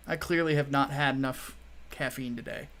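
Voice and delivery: ordinary talk, nothing marked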